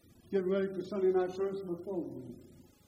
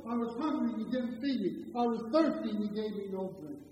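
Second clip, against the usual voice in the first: ordinary talk, nothing marked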